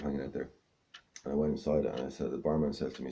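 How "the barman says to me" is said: usual speaking voice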